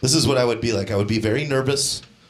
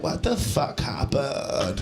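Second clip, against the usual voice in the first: slow, quiet, dazed morning-after voice; a woman waking up lost and muddled; groggy girl; 5 dB quieter, much slower than usual. Retold or imitated. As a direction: drag it out, half awake and confused. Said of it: "she's like".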